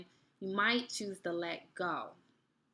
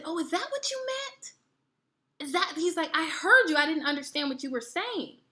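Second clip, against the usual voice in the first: much higher, surprised; surprised boyfriend; 7 semitones higher, 5 dB louder than usual. Retold or imitated. Boyfriend realizing what she meant, surprised. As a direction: play him caught off guard.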